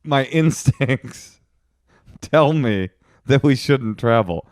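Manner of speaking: drawn-out, slow mock earnestness. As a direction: mimic partner dwelling on instincts. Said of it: "you were like".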